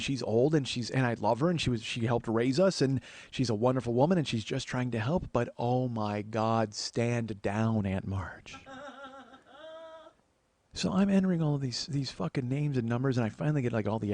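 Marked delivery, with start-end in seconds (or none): 5.3-8.6 low, slow, restrained plea; weary exasperation. Acted. Nephew silently begging Aunt Marge off, weary exasperation. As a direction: sink down low and slow, fed up.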